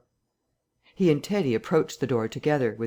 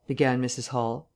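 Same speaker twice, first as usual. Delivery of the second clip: ordinary delivery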